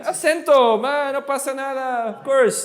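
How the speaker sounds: breezy, hammed-up reassurance; playful exuberance. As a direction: throw it out high with mock bravado. Teacher playfully celebrates the Spanish word.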